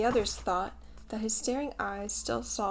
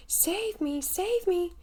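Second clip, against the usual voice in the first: high, louder pleading cry for help; frightened cry; 10 semitones higher, 4 dB louder, much slower than usual. A narrator's reading. Nibs, chased by wolves, crying out in fear. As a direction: beg to be saved in terror. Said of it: "cried Nibs".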